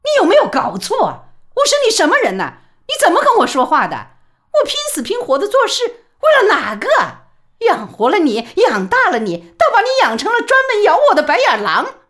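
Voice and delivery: very high, loud and fast, shrieking in rage; furious outburst. A narrator's reading. Mother finally exploding at her son, furious shriek. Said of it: "李宝莉终于不忍了".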